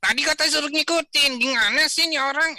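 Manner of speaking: put-on high, loud cheeky kid voice; child puppet complaining. Puppet boy Rio protesting to Kak Izul, in a high loud whine. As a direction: whine at the teacher like a little boy.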